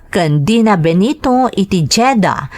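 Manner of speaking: usual speaking voice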